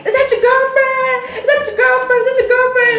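high, loud mock girl's voice calling out; girl in the background. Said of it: "I heard a girl in the back".

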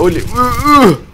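loud, very high whoops; excited whoops. Real, not acted. Player showing off mid-match, excited high whooping. Whooping as he dances round enemy.

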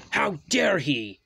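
loud, high outrage; big offended shout; thundering. A narrator's reading. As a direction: thunder it with full indignation. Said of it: "thundered Anti".

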